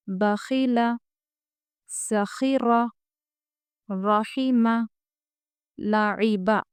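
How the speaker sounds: each word read out slowly and separately; slow word list. Shown as a demonstration. Teacher reading names aloud to pupils, slowly. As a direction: drill the names one by one for students. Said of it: "teacher reads word list".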